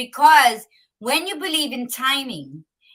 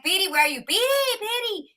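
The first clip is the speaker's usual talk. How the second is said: retold call, pitched way up; 9 semitones higher, faster than usual. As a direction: call out searchingly for a missing companion. Calling out for a companion.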